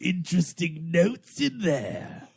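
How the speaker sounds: slow, sly hint-giver; game narrator voice. Retold or imitated. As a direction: tease like a smug narrator. Imitating CD-ROM game narrator.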